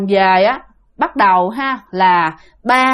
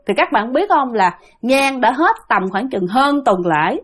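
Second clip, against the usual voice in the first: ordinary delivery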